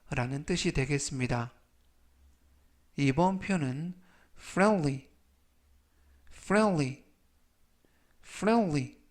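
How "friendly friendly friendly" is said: friendly said as FREN-lee, with the d barely heard; careful English pronunciation. Shown as a demonstration. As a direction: demonstrate friendly with the d almost dropped. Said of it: "라고 발음하시면 되겠고요".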